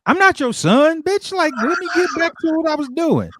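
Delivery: high put-on voice of an irritated young woman; lightly voiced indignant stranger, high and snippy; annoyed girl voice.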